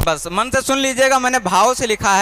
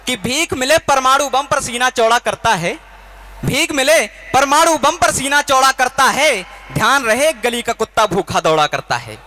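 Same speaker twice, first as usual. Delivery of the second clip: rising, fervent stage recitation of a patriotic verse; poem declamation; 3 semitones higher than usual. Acted. Poet to the audience at a kavi sammelan, fiery declamation. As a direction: build the lines up for the crowd, repeating with more force.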